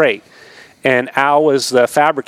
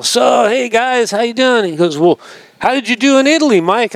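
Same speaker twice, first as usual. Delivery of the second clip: high, fast put-on voice of the team manager; mimicking team manager; 9 semitones higher than usual. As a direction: ham up the manager, pitched way up and quick. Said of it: "and he's all".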